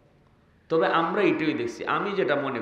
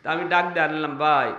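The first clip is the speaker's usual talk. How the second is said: normal talking voice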